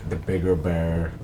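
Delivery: usual speaking voice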